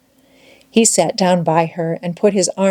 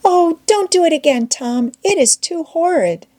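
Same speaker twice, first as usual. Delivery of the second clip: higher Becky begging him to stop; pitched-up plea, a touch scared; frightened Becky; 9 semitones higher than usual. A narrator's reading. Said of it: "said Becky".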